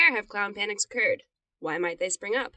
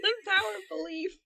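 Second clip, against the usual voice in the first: laughing; 11 semitones higher, 5 dB quieter than usual. Real, not acted. Laughing at own joke.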